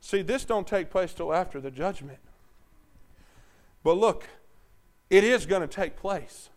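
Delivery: usual speaking voice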